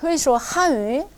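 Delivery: 회수한유 said slowly with careful tones; 회수한유 spelled out in slow Mandarin tones; 천천히 중국어 시범; slow Chinese demo. Shown as a demonstration. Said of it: "당신들 할 줄 아나요".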